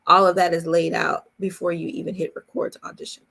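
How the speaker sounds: ordinary delivery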